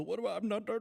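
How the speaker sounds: high put-on Indian accent; a sputtering scam caller with an Indian accent. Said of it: "And they go".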